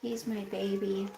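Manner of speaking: ordinary delivery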